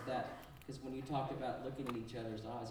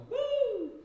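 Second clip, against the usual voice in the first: high falsetto woo; falsetto whoop; 12 dB louder than usual. Shown as a demonstration. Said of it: "pretty much any of us can go".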